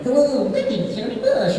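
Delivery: very high cartoon chicken monster; weird monster voice. Actor stuck in game voice everywhere he went, weird high monster. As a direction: introduce yourself in a silly high creature voice. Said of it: "talked with this weird voice".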